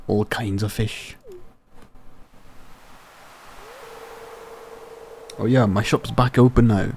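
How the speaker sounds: hearty Scottish-tinged sailor brogue; Willie, Scottish accent. Acted. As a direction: play an old fisherman with a makeshift Scottish accent. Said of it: "turned into a Scottish accent".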